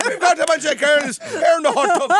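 high mock Irish brogue, close to laughing; badly faked Irish accent, played for laughs; attempted Irish brogue. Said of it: "Are you doing an Irish brogue".

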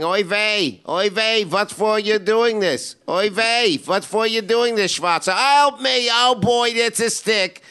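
hammed-up Yiddish accent, pleading yell; mock Yiddish accent crying for help. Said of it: "He's yelling".

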